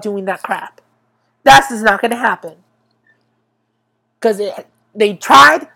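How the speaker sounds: loud, insistent telling-off of the networks; fired-up loud complaint; heated rant. Real, not acted. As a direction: scold the executives hard. Ranting at networks about news.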